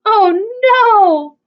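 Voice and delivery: high loud dismayed cry. A narrator's reading. House blown down.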